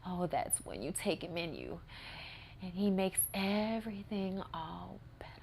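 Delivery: soft, breathy, dreamy whisper; creepy whisper. Retold or imitated. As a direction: lure her in with a hush. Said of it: "she's like".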